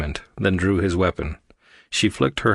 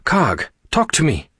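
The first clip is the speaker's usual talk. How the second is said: high, slow insistent demand; insistent, pitched-up command; 9 semitones higher, 4 dB louder than usual. A narrator's reading. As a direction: press hard for an answer. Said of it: "Aria demanded".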